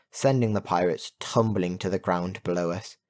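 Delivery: usual speaking voice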